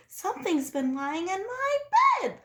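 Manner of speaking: little bear voice. A narrator's reading. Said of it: "Little Wee Bear pointed".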